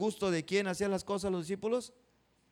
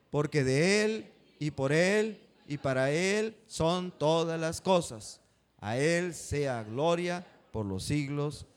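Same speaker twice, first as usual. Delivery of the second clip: slow, louder, measured recitation with the congregation; reading aloud together; 5 dB louder, slower than usual. Shown as a demonstration. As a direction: read each phrase slowly so they repeat it. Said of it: "¿Lo quieren leer conmigo esa parte?".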